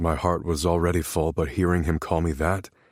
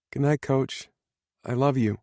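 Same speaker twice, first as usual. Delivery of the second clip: put-on little boy's goodnight; 8 semitones higher than usual. Child says goodnight to coach.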